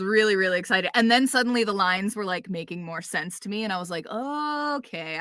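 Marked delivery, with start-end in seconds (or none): 4.1-5.2 high, long drawn-out "okay"; retold aha moment, pitched up and slow; dawning realization. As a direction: stretch it out as it clicks. Retelling her moment of realization.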